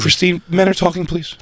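high, hammed-up pompous man; mock chauvinist. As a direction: scold like a pompous old-fashioned man. Mock sexist scolding.

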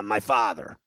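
ordinary talk, nothing marked

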